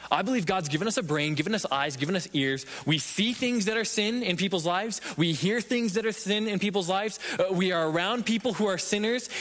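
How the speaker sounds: ordinary talk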